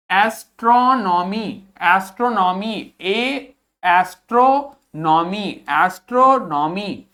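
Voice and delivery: एस्ट्रोनॉमी drawn out slowly, ए first alone; slow model pronunciation. Shown as a demonstration. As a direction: break एस्ट्रोनॉमी down slowly for learners. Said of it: "क्या पढ़ना है इसको हमें?". (धीरे-धीरे एस्ट्रोनॉमी)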